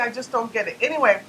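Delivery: usual speaking voice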